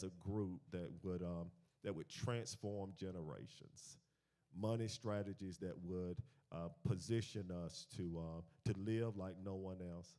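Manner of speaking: earnest spoken prayer; closing prayer after the sermon, earnest. Acted. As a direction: pray for the group.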